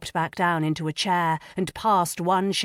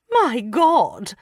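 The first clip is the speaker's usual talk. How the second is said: higher, louder horrified cry; mother crying out in shock; 7 semitones higher, 6 dB louder than usual. A narrator's reading. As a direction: gasp it out in horror. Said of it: "Lady Sophie cried".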